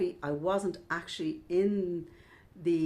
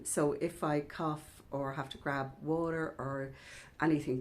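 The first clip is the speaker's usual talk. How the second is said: ordinary talk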